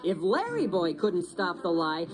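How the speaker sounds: ordinary delivery, nothing marked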